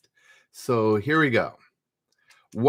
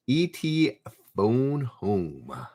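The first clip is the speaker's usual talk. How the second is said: slowly drawn-out E.T. line; slow, deliberate quoting of the film line; slow movie quote; much slower than usual. Retold or imitated. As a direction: stretch each word like the film quote.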